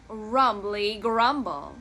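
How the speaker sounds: ordinary delivery, nothing marked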